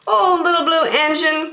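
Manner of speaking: high, begging call; pleading toys. Acted. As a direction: cry out to the little engine. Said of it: "cried the dolls and toys".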